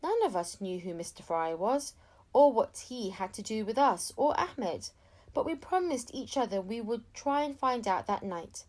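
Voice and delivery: usual speaking voice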